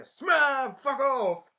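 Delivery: high, loud retort; imitating a hero losing his temper; angry shout. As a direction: bark it at the guards. Hero tells guards off.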